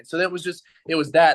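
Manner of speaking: ordinary talk, nothing marked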